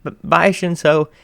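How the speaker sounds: stammering bye to Shinso; nervous stammer. A narrator's reading. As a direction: stumble over the bye.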